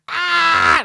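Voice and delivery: imitated scream of pain, high and loud. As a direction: let out a sharp, high cry like a bullet is being pulled. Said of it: "like that, you know?".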